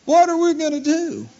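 high, anxious mock plea of a crying couple; desperate plea. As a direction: plead like a frightened young couple. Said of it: "They were just crying and bawling".

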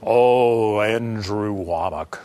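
mock a pompous religious prayer; pious prayer voice. Churchgoer addressing the preacher like God, low and solemn. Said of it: "all of a sudden you just go".

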